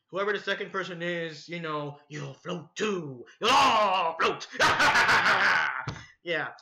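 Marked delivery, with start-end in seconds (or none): 2.1-4.5 slow, drawn-out creepy clown; sinister chant swelling loud; Pennywise. Acted. Teasing the viewers as the clown, slow and sinister. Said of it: "after meeting Pennywise in person". 4.5-6.0 loud, long evil clown cackle; villainous cackling laugh; Pennywise. Acted. Clown gloats at the viewers, evil cackle. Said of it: "after meeting Pennywise in person".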